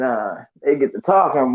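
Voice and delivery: usual speaking voice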